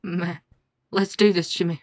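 a low grave vow to fight; deep grave voice. Acted. Grave duel challenge voice.

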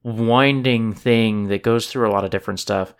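ordinary talk, nothing marked

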